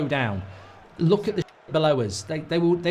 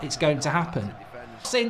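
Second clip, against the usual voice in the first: ordinary talk, nothing marked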